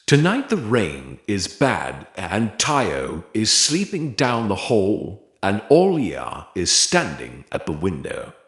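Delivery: ordinary delivery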